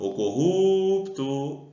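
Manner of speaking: "corrupto" with a long held "ru"; calling the word. Shown as a demonstration. Teacher calls the word to find its stress, drawn-out "ru" in "corrupto". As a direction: call out "corrupto", stretching the middle syllable. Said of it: "Só chamar".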